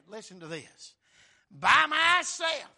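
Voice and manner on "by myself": slow, louder and higher voice of God; God's voice. Acted. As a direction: proclaim as God himself. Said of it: "this is God speaking".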